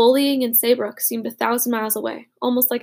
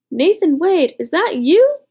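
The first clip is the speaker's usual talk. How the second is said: put-on higher, louder surprised young soldier; Butch's raised, surprised voice; Butch voiced; 6 semitones higher, 7 dB louder, slower than usual. A narrator's reading. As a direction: call out in startled recognition, pitched up. Butch recognizes Nathan by fire.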